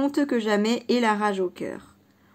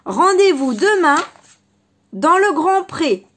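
calling out; 6 semitones higher, 8 dB louder than usual. Acted. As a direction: call the meeting place out across the field. Rabbit calls out challenge.

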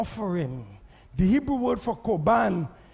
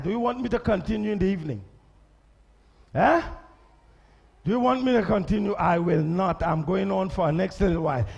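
normal talking voice

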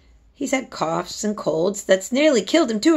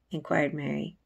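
usual speaking voice

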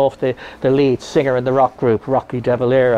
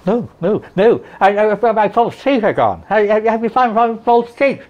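higher, anxious pleading; panicky; 6 semitones higher than usual. Retold or imitated. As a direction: fret about the lost teeth in a raised voice. Panicked patient asking for teeth.

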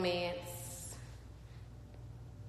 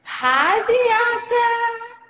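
very high, loud, drawn-out greeting; excited shout; 16 dB louder, much slower than usual. Real, not acted. Host greets guest connecting on live, excited shout. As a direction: squeal hello to your guest.

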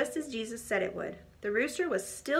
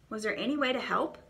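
ordinary delivery

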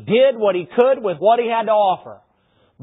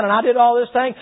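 ordinary talk, nothing marked

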